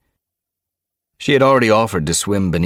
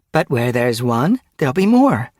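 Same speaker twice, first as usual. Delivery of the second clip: high, loud, eager mew; hopeful; 9 semitones higher, 7 dB louder than usual. A narrator's reading. Lightleap encouraging the others about prey, hopeful. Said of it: "Lightleap mewed hopefully".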